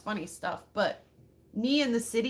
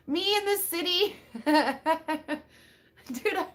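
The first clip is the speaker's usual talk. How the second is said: laughing; 6 semitones higher, slower than usual. Real, not acted. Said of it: "laughing at herself".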